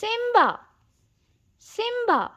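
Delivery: father calls for his missing cub; calling out. Acted. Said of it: "Mufasa called".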